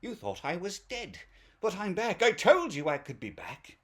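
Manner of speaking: high, theatrical villain announcement; imitating a vengeful voice from beyond; villain's voiceover. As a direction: gloat like a hammed-up movie villain. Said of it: "the voiceover comes over and he's like".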